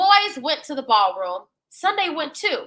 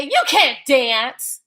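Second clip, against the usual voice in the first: teasing echo; much slower than usual. Acted. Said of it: "Joe echoed".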